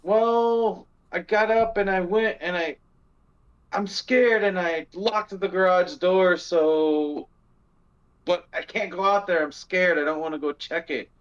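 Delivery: mock scared wife explaining she locked the door. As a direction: pitch up and play her fear on the phone. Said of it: "And she's like".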